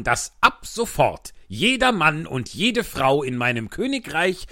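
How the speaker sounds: loud, slow, high proclamation; pompous royal decree. Acted.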